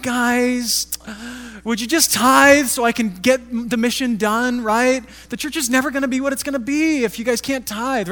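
mock whiny preacher begging for money; whining beggar. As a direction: beg the crowd for tithes like a needy street-corner beggar. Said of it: "He's not whining".

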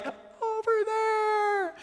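very high, drawn-out far-off call; thin, stretched mock holler from afar; imitated distant yell. Stranger in the water yelling up to a cliff jumper, imitated distant yell. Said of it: "he's yelling at me".

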